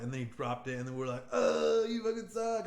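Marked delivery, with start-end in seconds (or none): 1.3-2.7 taunting yell, louder and higher; mocking yell. Friends jeering at a rival player, mocking yell. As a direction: jeer at the kid who dropped it.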